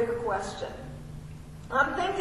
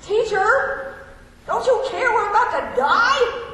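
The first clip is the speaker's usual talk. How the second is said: loud, high, with a laugh; half-laughing panic; 7 semitones higher, 5 dB louder than usual. Acted. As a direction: yell it in mock panic. Disciples yelling at Jesus.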